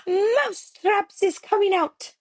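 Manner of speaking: high, loud witch's proclamation; the Grand High Witch bellowing her triumph; shrieking to the gathered witches; witch's shout. A narrator's reading. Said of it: "She raised her voice louder than ever and shouted".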